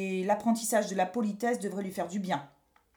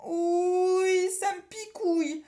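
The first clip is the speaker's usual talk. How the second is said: high begging cry; a boy begging for relief; pleading whine; 8 semitones higher, 4 dB louder, slower than usual. A narrator's reading. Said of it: "supplia-t-il".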